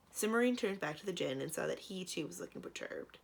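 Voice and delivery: usual speaking voice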